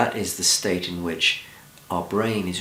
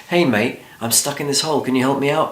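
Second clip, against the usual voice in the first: higher, louder, urgent plea from a hole; urgent calling out; 6 semitones higher, 4 dB louder than usual. Retold or imitated. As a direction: call up to your mate, higher and louder, desperate for help. Man in hole calls to friend.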